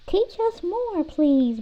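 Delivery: eager pleading; begging. A narrator's reading. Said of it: "begged Karen".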